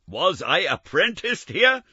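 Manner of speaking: high, loud, slow wonder; excited Scrooge. Acted. Scrooge to the ghost, amazed and loud. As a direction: marvel at the place.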